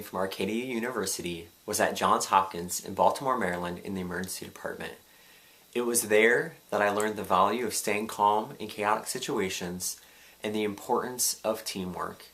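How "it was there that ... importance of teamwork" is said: ordinary talk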